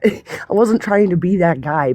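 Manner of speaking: ordinary delivery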